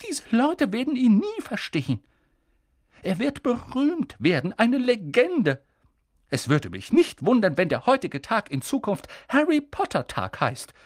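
stern witch pushing for answers; urgent McGonagall. A narrator's reading. McGonagall predicting.

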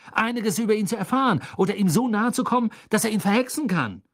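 high, insistent accusations; heated argument. Acted. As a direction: press the suspicion hard on a friend. Escalating quarrel about Krum's motives.

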